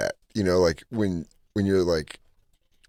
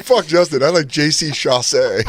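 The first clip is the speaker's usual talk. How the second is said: put-on higher and louder, gushing like a smitten teen girl; mock fangirl gush; 6 semitones higher, 4 dB louder than usual. Friend imitating Katie's teen crush, high excited gush. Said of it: "She was like".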